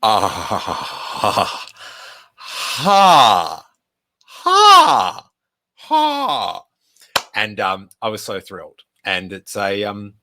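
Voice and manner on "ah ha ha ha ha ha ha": separated, hammed-up laughs leaping upward; imitated burst of laughter. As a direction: act out an over-the-top laugh. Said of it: "So I burst out laughing".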